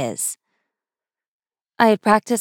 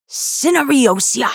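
sharp hissed rebuke, drawn out; a mother's furious hiss at her daughter; 6 semitones higher, 4 dB louder, slower than usual. A narrator's reading. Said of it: "my mother hissed".

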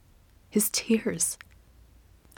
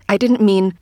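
urgent; 7 dB louder than usual. Acted. Apology, cut off.

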